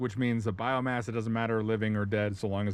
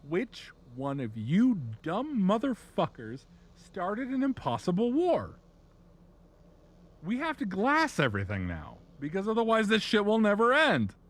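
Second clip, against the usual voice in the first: hammed-up furious lecture, pitched way up; mock outraged Necron; 11 semitones higher, 5 dB louder than usual. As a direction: build exasperation to a shouted finish. Necrons rage at everyone.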